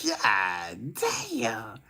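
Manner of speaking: hushed amazed aside; whispered. Real, not acted. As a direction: breathe it out in awe. Hushed awe after joke.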